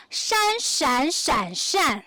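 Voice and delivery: "shan" four times: high level, rising, dipping, falling; four Chinese tones. Shown as a demonstration. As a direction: demonstrate each Mandarin tone on "shan". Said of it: "we have four accents".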